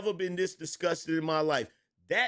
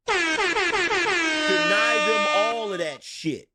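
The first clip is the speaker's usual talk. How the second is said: slow, loud, higher with echo effect; echoed emphatic repeat; 5 semitones higher, 6 dB louder, much slower than usual. Acted. Streamer repeats his point with a booming echo. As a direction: drag it out like a dramatic echo.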